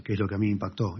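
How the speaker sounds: ordinary delivery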